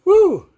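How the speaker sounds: high, loud short woo; piercing little "woo" after a breath; sudden whoop. Real, not acted. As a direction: let out a quick high whoop.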